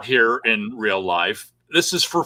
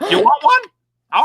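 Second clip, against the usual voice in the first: silly joke voice; 6 dB louder, slower than usual. Acted. Joke setup to host.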